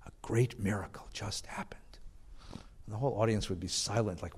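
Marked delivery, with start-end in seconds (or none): 0.0-1.8 put-on hushed, breathless whisper with a European accent, much softer. A lecturer imitates Heschel opening his lectures in a breathless whisper. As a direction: lean in and breathe the words like an old European sage. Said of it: "in a breathless voice with a European accent".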